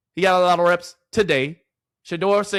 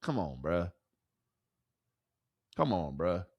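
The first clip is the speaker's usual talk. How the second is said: much lower and softer, a weary mutter; low muttered groan; 12 semitones lower, 12 dB quieter than usual. Real, not acted. Streamer mutters at the Browns' choice, low and fed up. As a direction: sigh it out deep, fed up.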